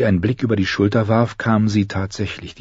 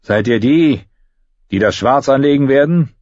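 loud, raised question to a crowd; guard's loud, higher-pitched challenge; calling out; 7 semitones higher, 5 dB louder than usual. A narrator's reading. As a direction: call it across the yard. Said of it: "rief einer der Goldröcke".

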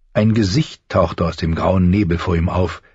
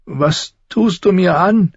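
high, slow tearful plea; a touch of crying in a raised boy's voice; 12 semitones higher, slower than usual. A narrator's reading. Boy asks crow what it's doing, tearful. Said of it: "fragte er die Krähe unter Tränen".

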